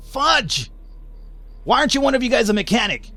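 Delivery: high, exasperated cry of dismay; dismayed outburst. Real, not acted. Gamer dismayed that nobody in his party is a mechanic, high exasperated cry. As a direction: yelp it out in disbelief.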